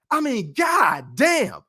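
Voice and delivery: slow, drawn-out shout of disbelief; exasperated shout. Real, not acted. Exasperated outburst over a boxer.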